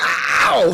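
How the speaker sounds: high, loud imitated ow; mock yelp of pain. Big guy yelps when punched, loud high mock ow. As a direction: yelp like you just got punched. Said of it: "That boy was like".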